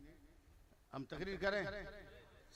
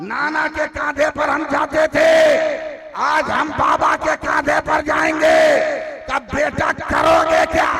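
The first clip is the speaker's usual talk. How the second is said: preaching shout; 6 semitones higher, 6 dB louder than usual. Acted. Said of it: "Hussain ने मुस्कुराकर कहा".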